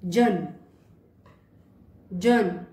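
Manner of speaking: French jeune, clear; French word demo. Shown as a demonstration. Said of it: "example". (जन French "jeune" की तरह)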